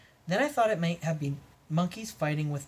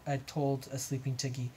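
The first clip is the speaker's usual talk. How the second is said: usual speaking voice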